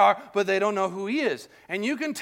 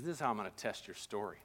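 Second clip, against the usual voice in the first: low threat; 11 semitones lower, 11 dB quieter than usual. Acted. Joseph's threatening test, read low.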